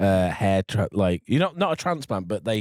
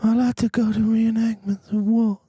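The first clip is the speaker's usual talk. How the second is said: high put-on voice of the killer; murderer's voice; 10 semitones higher, 4 dB louder than usual. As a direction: up in his register, a little louder. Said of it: "He goes".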